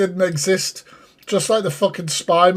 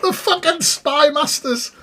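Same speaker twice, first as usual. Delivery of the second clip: incredulous mocking exclaim; 10 semitones higher than usual. Real, not acted. Streamer mocking the clip's spy master claim, incredulous high exclaim. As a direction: scoff at the absurdity, voice shooting up.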